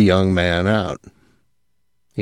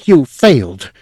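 grated; 5 semitones higher, 4 dB louder than usual. A narrator's reading. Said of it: "he grated".

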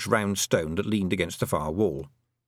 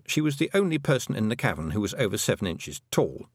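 plain reading voice

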